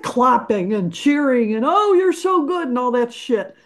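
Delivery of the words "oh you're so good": mock fan gush, pitched higher. Musician mocking fans' praise, gushing fake compliment. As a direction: mimic a fawning audience member.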